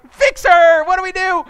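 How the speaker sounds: high, loud, desperate plea; frantic shout. Retold or imitated. Father yelling at his wife to save the baby, frantic shout. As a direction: yell for your wife to save her. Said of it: "You know, like".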